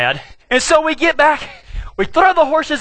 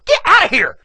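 annoyed wife shooing; 7 semitones higher than usual. Retold or imitated. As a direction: shoo the bug off, irritated. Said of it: "I hear her go".

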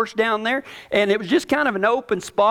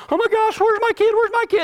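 frantic cry, much higher pitch; panicked parent. Retold or imitated. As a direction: call out like a terrified parent looking for his kid. Scared parent searching for child.

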